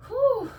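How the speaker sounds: high, short exhaled "whew"; relieved whew. Real, not acted. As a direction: blow out the effort. Recovering breath after exercise.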